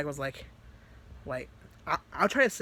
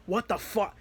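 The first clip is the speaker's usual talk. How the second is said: terrified; 5 semitones higher than usual. Retold or imitated. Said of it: "she was terrified".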